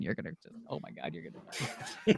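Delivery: breathless laugh. Real, not acted. Teasing podcast hosts about her book, laughing. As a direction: giggle too hard to finish.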